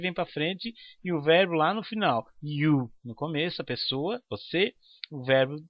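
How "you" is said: normal talking voice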